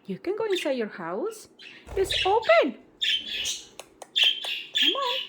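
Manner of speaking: very high sing-song coaxing voice; coaxing pet talk. Real, not acted. Owner coaxing Charlotte into her cage, high sing-song. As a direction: sweet-talk the bird back into its house.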